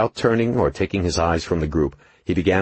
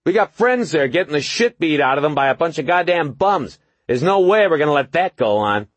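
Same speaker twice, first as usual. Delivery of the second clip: high, loud heated tirade; angry young thug; 11 semitones higher, 5 dB louder than usual. A narrator's reading. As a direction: rant at the cop. Angry youth confronts police.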